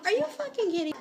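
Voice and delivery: high-pitched disbelief; incredulous. Retold or imitated. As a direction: squeal it like you can't believe it. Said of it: "I'm like".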